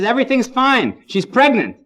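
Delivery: put-on an old man showing off his news; proud old groom. Proud eighty-five-year-old groom answering.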